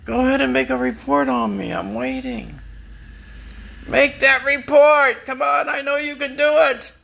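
high, sing-song taunting voice; mocking taunt. Taunting the neighbors to file a report, high and mocking.

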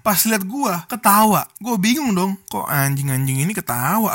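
ordinary talk, nothing marked

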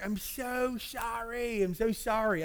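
high, pleading mock-abject apology; acted apology. Husband to wife after losing his temper, pleading apology.

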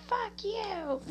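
high mock yell of "Fuck you!". Caller yelling at a praying family on the radio, a mild mock yell. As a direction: toss off a mild imitated yell at the car. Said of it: "somebody just yelled at".

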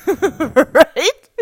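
a loud laugh bursting into the word. Real, not acted. To listeners, after joking about crashing into bed, laughing. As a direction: laugh big, then ask Right. Laughing at her own exhausted joke.

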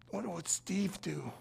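slow, soft whisper; drawn-out breath of a question; hushed whisper. Shown as a demonstration. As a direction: whisper it like a secret motto. Mock-reverent motto, whispered.

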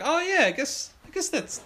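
high-pitched hesitant dad imitation; dad's voice. Dad half recognizing his portrait, high mock hesitation. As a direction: concede it in a high put-on voice. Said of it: "and he's like".

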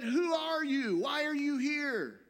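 very high, loud sentry's shout; challenging soldier. Retold or imitated. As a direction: bellow it as a guard's challenge. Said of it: "a soldier steps out from the shadows with a gun".

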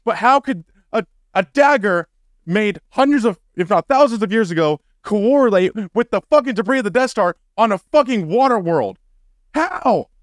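high-pitched incredulous rant; angry disbelief. Real, not acted. Podcaster demanding how a dagger could match, angry. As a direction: build the indignant question up to a high, wailed last word. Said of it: "I'm so fucking angry".

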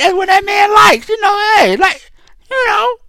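high-pitched, shouted emphatic repeat; hyped correction shouting the point; excited shout. Real, not acted. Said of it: "repeated and corrected".

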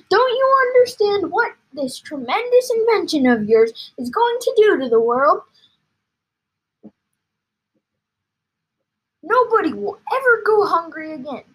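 loud, high, grand exclaiming; carried-away father; worked-up excitement. A narrator's reading. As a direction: proclaim it like a wonder for the world. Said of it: "working himself so much".

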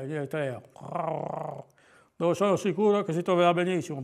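fussy owl with weakened r's; pompous owl. Acted. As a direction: owl musing in a put-on speech defect. Voices Gufo thinking aloud.

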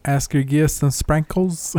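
put-on a clumsy, slow try at a Scottish accent; bad Scottish accent. A podcaster attempts Scottish and admits he is bad at it. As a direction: mangle it in mock Scottish. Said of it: "I'm not very good at Scottish either".

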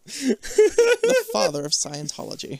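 mock solemn announcement; grand announcement. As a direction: announce it like a legend's name.

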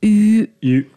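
ゆ said loud and high as a sound demo; sounding out ゆ on its own; pron demo. Shown as a demonstration.

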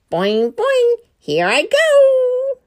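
high, slow, bouncy cartoon hero; cartoon rabbit voice. A narrator's reading. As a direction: sing it out like a springy bunny. Super Rabbit Boy bouncing.

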